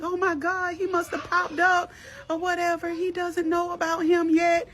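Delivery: high-pitched panicky retelling; mock panicked wail. Mother on the phone learning of it, mock panicked wail. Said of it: "she started screaming and crying".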